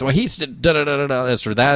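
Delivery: ordinary talk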